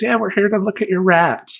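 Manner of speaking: put-on high, sweet girlish voice; girlfriend voice. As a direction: lift into a perky girl's voice. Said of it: "a girl I'm with says".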